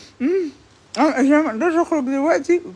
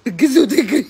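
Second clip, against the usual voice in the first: laughing; 5 dB louder than usual. Real, not acted. Laughing at fleeing men.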